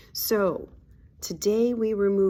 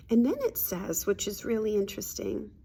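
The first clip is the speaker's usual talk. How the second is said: ordinary delivery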